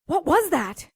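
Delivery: startled, loud and high; jolted by a gunshot right beside her. Acted. Partner asks about the deafening shot, startled.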